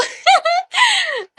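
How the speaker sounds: high, shrieking burst of laughter; squealing laugh. Real, not acted. Streamer cracking up at her own joke, squealing laugh. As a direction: let out a sharp squeal of a laugh. Laughs after "ganito kami.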